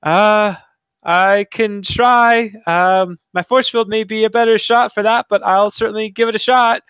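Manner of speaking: high-pitched, earnest character voice; eager young hero. Acted. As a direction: offer help in a high voice.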